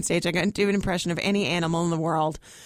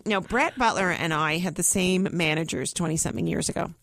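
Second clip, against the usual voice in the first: normal talking voice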